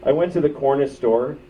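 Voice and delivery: ordinary talk, nothing marked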